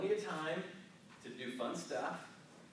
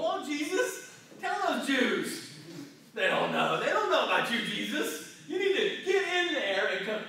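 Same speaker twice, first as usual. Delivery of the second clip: mock eager zeal; 11 semitones higher, 12 dB louder than usual. Mocking eager church people.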